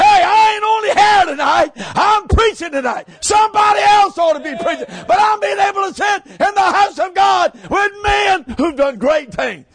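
loud, high shouted preaching; fiery preaching. Acted. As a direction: preach it at full force to the congregation. Preaching at full force.

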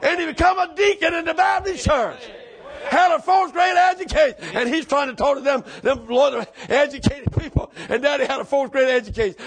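impassioned preacher building to a holler; full-force preaching. Acted. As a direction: tell the testimony high and loud.

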